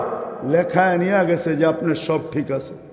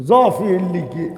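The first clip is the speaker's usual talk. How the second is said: normal talking voice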